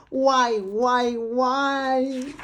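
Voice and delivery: loud, exasperated repeated why; whining why. Acted. Pigeon complains to the reader, loud whine. Pigeon fumes about asking.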